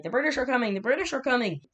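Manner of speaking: high-pitched mock town-crier warning; alarmed messenger. Rider warning a village about the British, mock alarm. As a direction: call it out like a rider raising the alarm. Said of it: "he was like".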